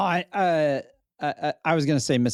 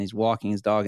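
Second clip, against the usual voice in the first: ordinary delivery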